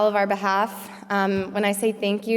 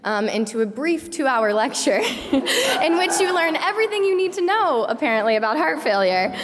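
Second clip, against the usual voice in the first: laughing; 7 semitones higher, 4 dB louder than usual. Real, not acted. Laughing while teasing the lecturers.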